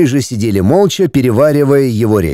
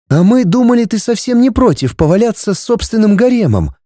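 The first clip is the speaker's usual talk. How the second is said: playful higher purr; 8 semitones higher than usual. Acted. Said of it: "промурлыкала Таня".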